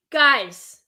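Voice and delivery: normal talking voice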